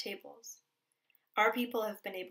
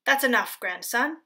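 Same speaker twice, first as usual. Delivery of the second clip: louder, higher grandpa scolding; 3 semitones higher, 10 dB louder than usual. A narrator's reading. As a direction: snap it out like a stern grandfather. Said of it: "Grandpa would scold".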